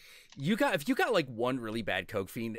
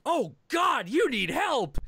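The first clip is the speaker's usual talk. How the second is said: high, louder alarmed cry for help; alarmed concern; 14 semitones higher, 5 dB louder than usual. Retold or imitated. To a meth user, high alarmed concern. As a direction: gasp it out in shocked worry. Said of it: "meth is like".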